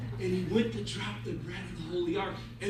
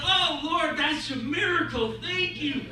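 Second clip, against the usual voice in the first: amazed gratitude; 5 semitones higher than usual. Acted. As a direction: exclaim it like a man given a miracle. Janitor finds bread, thanks God.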